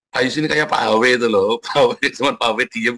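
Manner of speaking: ordinary talk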